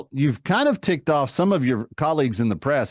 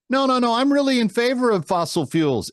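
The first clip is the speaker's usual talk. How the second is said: higher, whiny backpedaling voice; mocking Kamala Harris; 7 semitones higher than usual. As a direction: play her squirming out of it.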